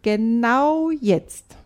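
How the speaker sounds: put-on boy telling his sister firmly and slowly. A narrator's reading. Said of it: "sagt Tom bestimmt".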